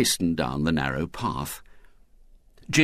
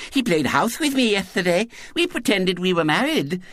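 put-on high, lisping child telling tales; indignant little girl; 12 semitones higher than usual. A narrator's reading. Girl exposing William before his friends, indignant. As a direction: insist indignantly in a small girl's voice. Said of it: "she said indignantly".

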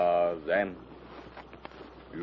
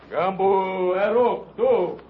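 booming brutish orders; dopey cartoon bellow; 10 semitones higher than usual. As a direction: bark like a dim savage chief. Savage chief orders followers.

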